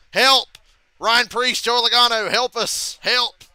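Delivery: hammed-up plea to teammates; mock call for help. Leader with rivals behind calls teammates, mock loud plea.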